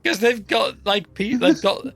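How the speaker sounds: high, slow laughing recall; laughing too hard to talk. Real, not acted. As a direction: gasp through laughter. Laughing remembering show.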